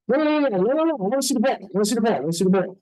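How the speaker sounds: high, rapid-fire mock insistence; insistent protest. Acted insistence on seeing ball.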